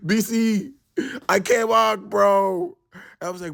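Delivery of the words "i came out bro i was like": ordinary delivery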